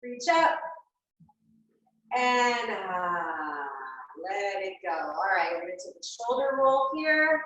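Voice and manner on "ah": big audible exhale sigh; dramatic sigh. Shown as a demonstration. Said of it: "just some sort of audible sound".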